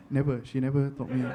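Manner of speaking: usual speaking voice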